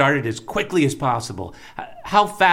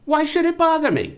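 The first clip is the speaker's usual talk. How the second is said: much higher, pushy question; incredulous defensiveness; 3 dB louder than usual. Acted. Husband shrugs off being jealous of himself, incredulous. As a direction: brush the worry off in disbelief. Defensive about wife cheating with him.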